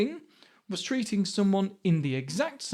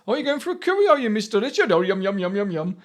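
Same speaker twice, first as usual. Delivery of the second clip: imitating a put-on Indian accent, pitched up and loud at first; mock Indian accent; 5 dB louder, faster than usual. Friend's mum teasing about going for a curry, mock Indian accent. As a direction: do her jokey Indian accent impression. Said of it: "she puts on this Indian accent".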